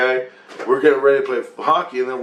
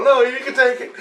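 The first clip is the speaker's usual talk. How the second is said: laughing high voice; 13 semitones higher, 3 dB louder than usual. Retold or imitated. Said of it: "I go".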